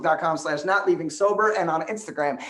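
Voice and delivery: fast, high, loud auctioneer patter. Retold or imitated. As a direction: rattle it off like a county auctioneer. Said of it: "he talks like a Gundy County auctioneer".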